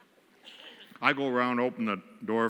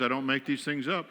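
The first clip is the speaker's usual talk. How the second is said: ordinary talk, nothing marked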